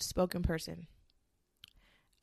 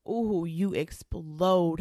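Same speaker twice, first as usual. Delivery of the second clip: long stretched ooh, slow and loud; drawn-out explode; 2 semitones lower, 4 dB louder, much slower than usual. Acted. To listeners about bottled anger, slow drawn-out ooh. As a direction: ham up the burst like a popping bottle.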